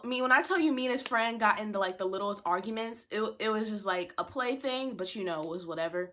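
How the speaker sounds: ordinary talk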